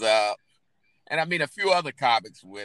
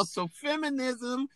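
put-on preachy girl comic. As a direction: parody a self-righteous comic, pitched way up. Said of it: "and they're like".

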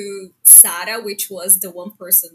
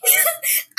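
high laugh. Real, not acted. Laughing while retelling.

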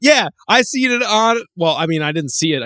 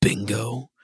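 quoting Leon; 6 semitones lower, 6 dB quieter than usual. Retold or imitated. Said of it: "And then he just goes".

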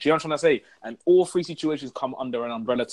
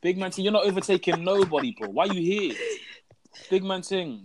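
higher, scornful rant at driver; mocking disbelief; 6 semitones higher than usual. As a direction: sneer at the slowpoke. Mocking slow lane driver.

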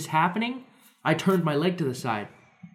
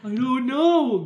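dazed; 10 semitones higher, slower than usual. Retold or imitated. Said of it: "And I was just like".

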